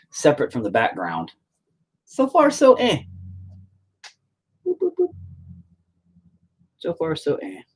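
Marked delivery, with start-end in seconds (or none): 2.0-3.0 very high, loud mock verdict; cartoonish high-pitched noncommittal quip; silly squeaky voice. Same phrase squeaked, then repeated quietly. 4.6-5.1 tiny squeaky boop noises; high playful boops. Pretend. Playful noises while painting. 6.8-7.6 moderately high, unforced silly voice; lightly high-voiced noncommittal quip; milder squeaky repeat. Same phrase again, softer.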